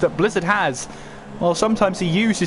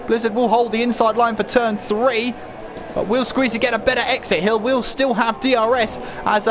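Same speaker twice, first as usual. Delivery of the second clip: rising live race call, building tension; motorsport commentator; 4 semitones higher than usual. Real, not acted. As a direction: call the battle with mounting urgency. Battle heats up, live call.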